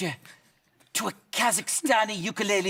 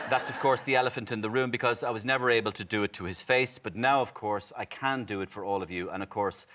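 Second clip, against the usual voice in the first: ordinary talk, nothing marked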